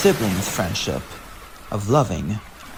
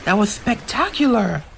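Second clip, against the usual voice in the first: high, amazed praise; admiring girl; 8 semitones higher than usual. Acted. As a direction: admire the finished castle. Admiring the castle.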